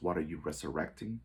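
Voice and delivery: ordinary talk, nothing marked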